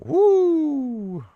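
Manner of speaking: high, drawn-out whoop; long woo. Real, not acted. Streamer reacting to a viewer's roster, long high woo. Reacts to seeing many good options.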